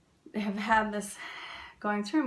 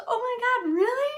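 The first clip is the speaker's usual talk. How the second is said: much higher and louder, thrilled cry; excited South African man; 8 dB louder than usual. Retold or imitated. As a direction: gush like you just met an American. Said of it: "He got so excited".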